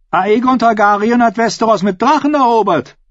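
high, insistent argument; defiant retort. Acted. Ascher argues with Euron about Aegon's conquest, high defiant retort. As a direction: drive the point home in a high voice.

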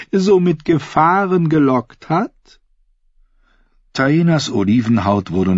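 high-pitched queen, prying playfully; teasing queen. Acted. Queen asking Taena about her lover, high teasing voice.

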